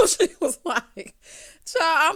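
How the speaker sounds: laughs loudly through the words; laughing. Real, not acted. Host laughs loudly at Heather's claim, high and loud.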